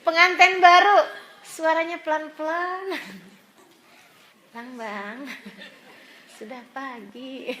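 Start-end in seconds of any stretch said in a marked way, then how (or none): 4.6-7.5 hushed, slow, very soft wake-up call; soft whisper. Pretend. Newlywed wife softly wakes her husband at dawn, hushed and slow. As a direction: murmur it gently so he barely stirs. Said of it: "Pelan banget suaranya, bisik-bisik gitu".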